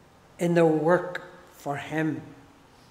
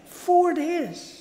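Four days, pitched way up in amazement; emphatic echo; 9 semitones higher than usual. Real, not acted. Preacher to congregation, stressing Lazarus's days dead, high echo.

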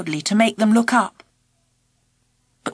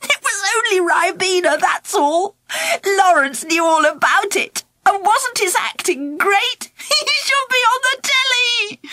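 giggly; 8 semitones higher than usual. A narrator's reading. Girl giggling over the trick.

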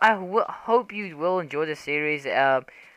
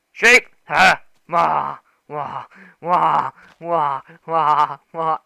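loud giddy shouts; real excitement, loud bursts; excited yelps; 8 dB louder, much slower than usual. Real, not acted. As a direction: yelp with glee at the sheep. Excited by a sheep.

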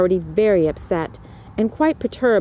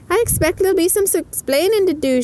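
Missus O'Malley voice; 11 semitones higher than usual. A narrator's reading. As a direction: lift the pitch way up and brighten. Missus O'Malley speaks to Mellie.

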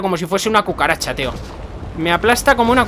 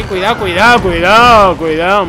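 loud, drawn-out cries of warning; watch-out calls swelling in volume; urgent warning; 7 dB louder, slower than usual. Real, not acted. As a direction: call out a repeated warning. Warns character during gameplay.

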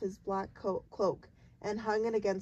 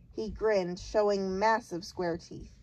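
plain reading voice

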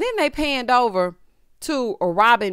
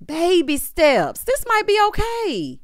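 higher, slow, hammed-up proud voice; mock proud; 10 semitones higher, slower than usual. Telling the cast she's proud, high slow mock praise. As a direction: play a sarcastic proud mother. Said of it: "I said".

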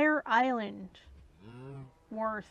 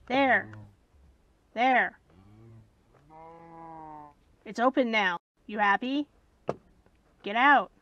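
shouting at cows; 4 semitones lower, 7 dB louder than usual. Real, not acted.

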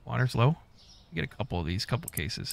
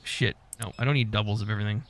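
ordinary talk, nothing marked

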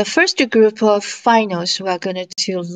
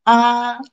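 "A" held high and perfectly level; first tone; 3 semitones higher, 3 dB louder than usual. Shown as a demonstration. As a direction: sustain "A" on one high, flat note. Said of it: "imitate the tones".

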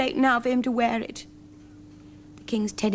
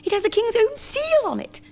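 very high squeaky mouse voice; high little mouse giving orders. A narrator's reading. Mice urge the bear.

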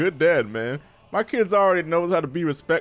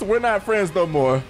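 ordinary delivery

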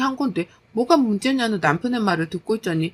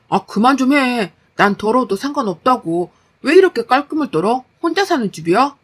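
irritated husband; 3 semitones higher, 6 dB louder than usual. Acted. Husband telling wife to stop fussing over tidiness, exasperated. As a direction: push back hotly against the nagging.

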